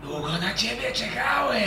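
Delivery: high, distant otherworldly voice; eerie voice in head. Acted. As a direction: speak from inside their minds. GM voices chained creature in head.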